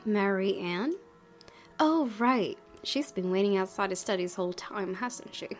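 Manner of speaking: ordinary talk, nothing marked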